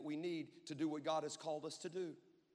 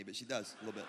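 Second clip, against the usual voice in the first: ordinary talk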